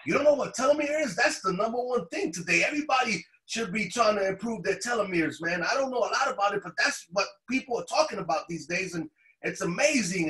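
high-pitched, eager rush; animated, incredulous enthusiasm; retold excitement. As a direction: imitate the excited doctor, starting very high and easing down. Excited doctor explaining telomeres in story.

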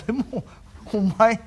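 slow, bashful, about to laugh; shy giggly voice. Acted. Young master says Kuma looks ready to laugh, giggly and slow. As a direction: fight back a shy giggle.

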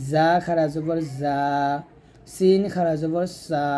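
buzzed "জা" and hissed "সা", each held long; tongue-on-teeth articulation. Shown as a demonstration. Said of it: "জিহ্বাটা নিচে দাঁতের মাঝে লাগিয়ে উচ্চারণ".

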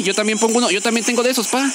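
usual speaking voice

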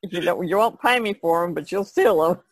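normal talking voice

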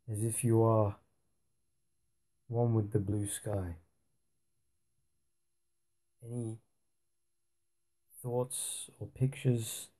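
soft, unhurried meditation voice with long pauses; guided meditation. Acted. Teacher leading listeners into a blue-sky meditation, softly. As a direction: guide them gently into stillness, hushed and spacious.

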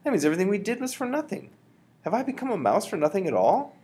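put-on fast, high, upset boy voice; young boy. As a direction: spill it out in dismay. Boy despairs.

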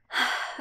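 long sigh before confessing; a sigh gathering courage to confess; heavy resigned sigh. Acted. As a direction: sigh to steel yourself. Bracing to confess.

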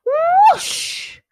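high, loud rushing whoosh; drawn-out storybook sound effect; big whoosh. A narrator's reading. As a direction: whoosh it out like something speeding past. Tiger whizzing down the banister.

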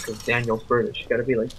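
ordinary talk, nothing marked

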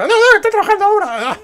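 very high loud squeak; obsequious underling. Acted. Underling telling the leader people are working, high squeaky reply. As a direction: pipe up in a squeaky put-on voice.

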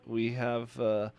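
usual speaking voice

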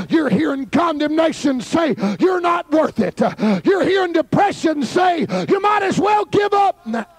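rising shouted preacher lines; preaching at full force. Acted. Preacher naming the voices of condemnation, shouting.

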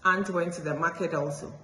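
usual speaking voice

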